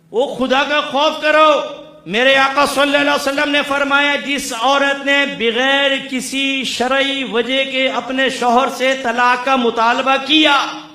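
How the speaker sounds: loud, high-pitched preaching at full force; fiery preaching. Acted. Preacher scolding husbands who unveil their wives, roaring. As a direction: thunder the rebuke at the congregation.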